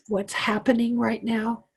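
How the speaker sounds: normal talking voice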